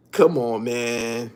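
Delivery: usual speaking voice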